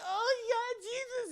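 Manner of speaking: very high pained wail; hammed-up injured woman in falsetto; mock anguish. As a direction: wail as if badly hurt. Retold woman injured and panicking.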